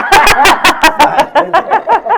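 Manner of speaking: long, loud, high cackling laugh; hearty laughter. Real, not acted. Demonstrator amused by new slime fan, hearty laughter. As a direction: laugh hard in a long run. Reacting to wish to tend slime.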